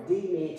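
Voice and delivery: "D man" with the accent on D; stress on first syllable. Shown as a demonstration. Teacher repeating the wrong accent, "D man" stressed on D. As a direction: hit the D of "D man", leave man weaker. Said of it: "moving the accent from the E to the A".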